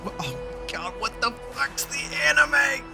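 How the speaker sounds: ordinary talk, nothing marked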